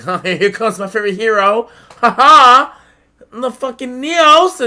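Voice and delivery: high, loud, gloating sass; mad sassy. Retold or imitated. Kid flaunting his favorite hero to an older duelist, mad sassy. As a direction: crow it way up high, showing off. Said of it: "I'm being mad sassy".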